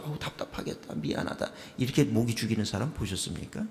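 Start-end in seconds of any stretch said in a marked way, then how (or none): 0.0-1.5 mock pity. Mock pity while spraying fly.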